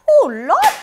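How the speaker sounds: high, loud "Oh Lord!"; exasperated cry. Retold or imitated. Reacting to the report, a loud dismayed "Oh Lord!". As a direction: throw up your hands in dismay. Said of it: "I was like".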